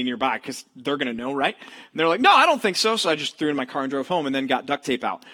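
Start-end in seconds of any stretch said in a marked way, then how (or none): 2.2-3.0 higher, louder casual no; high offhand voice of a passerby; bystander's answer. Retold or imitated. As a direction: toss off a stranger's quick answer.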